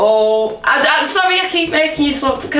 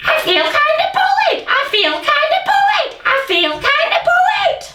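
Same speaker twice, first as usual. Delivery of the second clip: much higher squawky parrot voice. Pretend. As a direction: parrot it back, high. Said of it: "you talk like a parrot".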